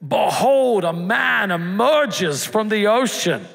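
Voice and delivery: booming ritual cry, drawn out across the beach; loud proclamation. Retold or imitated. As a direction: herald a new man from the sea. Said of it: "I yell out in a loud voice".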